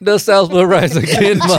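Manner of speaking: slow, high, laughing drawl; hammed-up Southern voice; Southern accent.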